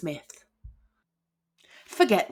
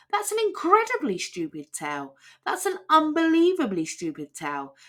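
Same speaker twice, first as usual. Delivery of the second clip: building scorn; 9 semitones higher than usual. Acted. Jack mocking giant's tale.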